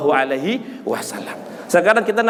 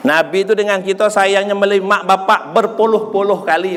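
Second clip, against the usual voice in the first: normal talking voice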